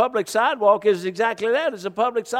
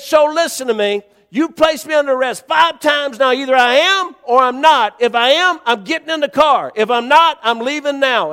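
loud, higher, forceful argument; retold ultimatum; 7 semitones higher, 7 dB louder than usual. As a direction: lay out the final choice to the officer. Retold final ultimatum to officer.

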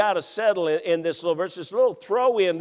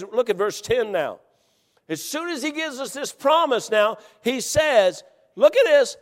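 ordinary delivery

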